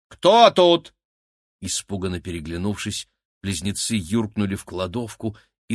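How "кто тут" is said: high, very loud challenge; exclaiming. A narrator's reading. Cleaning woman calls out to whoever is hiding, exclaiming. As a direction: shout it high at an unseen intruder. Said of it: "воскликнул женский голос".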